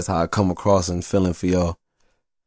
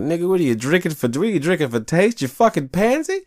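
high, loud ribbing; high scornful buddy jeer; mocking taunt; 3 dB louder than usual. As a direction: razz him hard, pitched high and loud. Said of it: "And I'm like".

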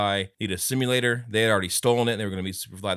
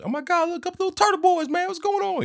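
much higher, a badly faked [name]; bad [name] impression; 3 dB louder than usual. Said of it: "that's a horrible [name] impression".

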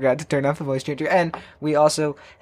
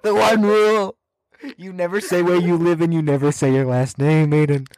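mock scolding; 7 semitones higher than usual. Scolding friend over leaked name.

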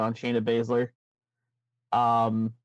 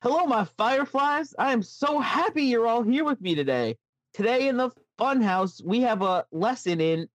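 put-on much higher, sing-song children's host voice; sweet, high kids' TV host welcome; Firefly Funhouse host; 9 semitones higher than usual. Host sweetly greeting his Fireflies in a high voice. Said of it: "Let me take that again".

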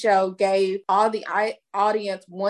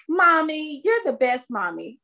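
put-on sweet child's voice; 7 semitones higher than usual. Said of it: "was like".

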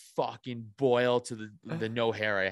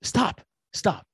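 imitating wife; 9 semitones higher, 3 dB louder than usual. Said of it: "my wife goes".

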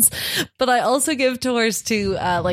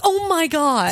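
high, mock-startled British voice; British accent; 7 semitones higher than usual. Woken sleeper reacting to a fire alarm, British accent. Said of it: "I think it would be British".

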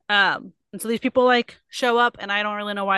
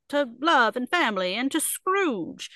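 slow, higher, drawn-out toast; mock earnest toast; 5 semitones higher, slower than usual. Bob toasts family and boss, mock heartfelt. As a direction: raise an imaginary glass grandly.

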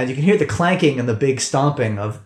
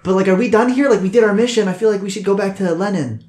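high-pitched character voice; 6 semitones higher, faster than usual. Acted. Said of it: "Commissar Cute's like".

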